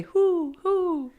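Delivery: high hooting owl call. Retold or imitated. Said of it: "you know how owls say".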